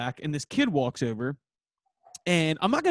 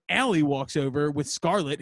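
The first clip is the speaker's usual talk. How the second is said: ordinary talk, nothing marked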